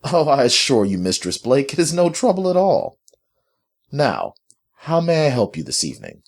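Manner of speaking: high, formally courteous tone; polite. A narrator's reading. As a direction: offer help with studied politeness. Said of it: "Mandrake spoke politely".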